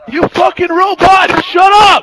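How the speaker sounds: loud, slow, high-pitched screaming at a teammate; furious yell. Real, not acted. Gamer screaming at a teammate, furious yell. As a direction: blow up at him, full volume.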